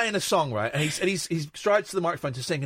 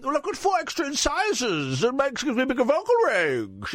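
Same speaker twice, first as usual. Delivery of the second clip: high, camp [name]; 6 semitones higher than usual. Retold or imitated. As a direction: fuss over the cats, darling. Freddie impression continues.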